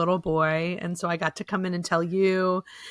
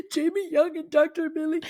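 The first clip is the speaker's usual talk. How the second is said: high-pitched imagined cheer; excited call-out; 9 semitones higher than usual. Retold or imitated. Son at graduation thanking his doctors, calling out excitedly. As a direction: call the names out high, in a put-on voice.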